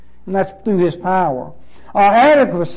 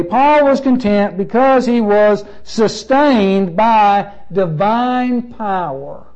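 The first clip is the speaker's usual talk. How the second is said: slow, emphatic; slower than usual. Real, not acted. Slow emphatic statement of the point.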